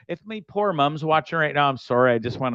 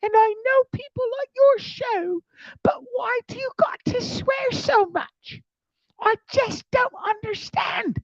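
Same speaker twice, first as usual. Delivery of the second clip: hammed-up whiny mom pleading; imitating his mom. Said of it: "And she's like".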